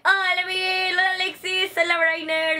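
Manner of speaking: high, loud called-out hellos; excited greeting. Real, not acted. Calling greetings to arriving viewers. (saludando con entusiasmo)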